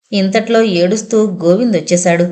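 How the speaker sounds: ordinary delivery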